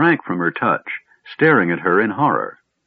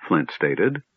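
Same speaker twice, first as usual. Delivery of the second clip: ordinary delivery, nothing marked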